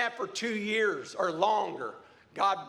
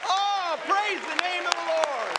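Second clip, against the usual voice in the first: raised, high-pitched praise over a cheering crowd; exultant preaching; 7 semitones higher than usual. Real, not acted. Preacher presenting a healed sister to the church, exultant preaching. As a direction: cry it out in triumph.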